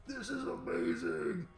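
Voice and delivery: high, squeaky, muffled cry of delight; a trapped little creature thrilled; muffled squeaky voice from inside. Acted. GM voicing a creature trapped inside the Behir, high and delighted. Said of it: "you hear a very muffled".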